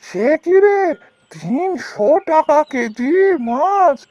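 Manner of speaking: shocked disbelief. Acted. Boatman balks at the fishmonger's price, shocked and slowed.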